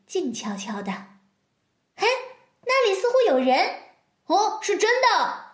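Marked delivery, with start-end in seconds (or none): 2.0-5.4 higher, loud excited cry; excited shout. Acted. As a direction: cry out in discovery. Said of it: "齐声地叫道".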